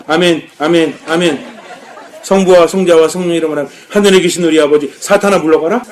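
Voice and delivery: hammed-up, confrontational praying aloud; mock loud prayer. Shown as a demonstration. Praying out loud before someone who provoked you, mock-loud. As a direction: pray pointedly at your opponent, badly overdone. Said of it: "소리 내서 앞에 상대방 두고".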